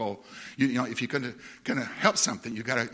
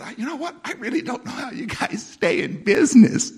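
higher and louder, cheerful teasing tone; real nice way; 10 semitones higher, 9 dB louder than usual. Retold or imitated. Friendly complaint to the manager about the company. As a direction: say it sweetly, like a friendly jab. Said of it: "I said in a real nice way".

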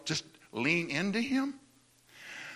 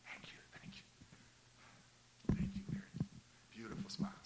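whisper; 21 dB quieter, much faster than usual. Real, not acted. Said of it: "whispered aside to a listener".

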